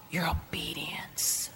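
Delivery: slow, intense whisper; whispered, drawn out for weight; hushed emphasis. Real, not acted. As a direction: drop to a slow whisper for the key point. Preacher hushes for the key word.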